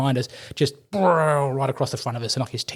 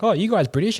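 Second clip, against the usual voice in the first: lightly voiced American, much higher; a hint of a puzzled American soldier; mock American question; 8 semitones higher than usual. Americans at Tim Hortons quiz him, lightly mimicked.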